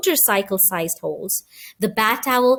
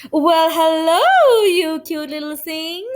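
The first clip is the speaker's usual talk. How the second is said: slow, louder, higher sing-song greeting; cooing maid; 4 semitones higher, 4 dB louder, slower than usual. A narrator's reading. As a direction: coo over a pet like a silly maid.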